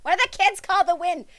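lightly voiced old grandma. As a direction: play a confused grandma groping for a word. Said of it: "Here's Grandma".

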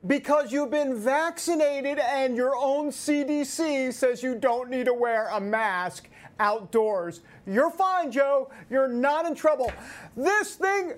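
worked up and yelling the point; screaming. Real, not acted. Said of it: "I'm sorry, I'm screaming".

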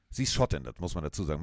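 usual speaking voice

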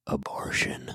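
soft, hushed single word; whisper. Shown as a demonstration. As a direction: drop to a whisper for the scandalous word. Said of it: "what are you gonna whisper".

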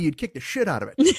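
ordinary talk, nothing marked

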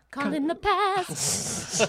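ordinary delivery

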